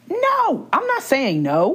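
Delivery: high, slow, emphatic no; defiant refusal. Real, not acted. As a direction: refuse it, drawn out and firm. Refusing mom's advice.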